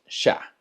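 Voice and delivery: chá with a soft sh. Shown as a demonstration. Teacher models Portuguese tea, chá as sha. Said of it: "Suena más como una S H".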